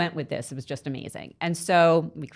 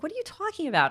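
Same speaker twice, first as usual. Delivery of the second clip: much higher, mock disbelief; incredulous; 12 semitones higher, faster than usual. Mom reacting to her crying daughter, incredulous and high. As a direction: brush off the panic with surprise. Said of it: "I was like".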